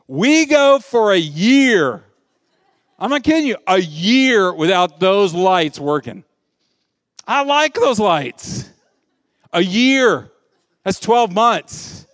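loud, drawn-out incredulous emphasis; comic outrage. Real, not acted. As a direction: stretch the words out slow and loud, aghast. Comic outrage at year without lights.

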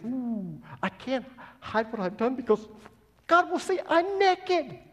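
comic panic climbing to a loud, high cry; mock panic. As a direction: play Adam caught out, squealing upward in mock dread. Acts out Adam panicking at exposure.

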